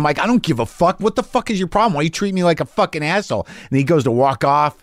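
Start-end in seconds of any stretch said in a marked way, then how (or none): 0.2-3.5 retold screaming. Yelling at a young comic in the hallway, mock screaming. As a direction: replay the outburst high and agitated, without full volume. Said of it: "and I'm screaming".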